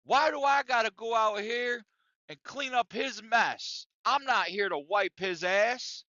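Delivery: retold workplace outburst, moderately heated; imitated angry shouting. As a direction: act out a coworker's indignant complaint, part-shouted. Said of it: "he's shouting with our manager and says".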